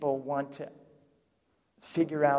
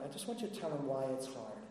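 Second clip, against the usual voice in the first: normal talking voice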